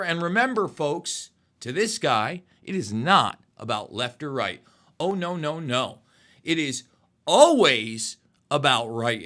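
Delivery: measured showman's sign-off with punched key words; broadcast sign-off. Acted. Show host's closing address.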